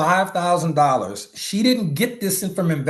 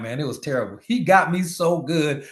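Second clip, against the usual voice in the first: normal talking voice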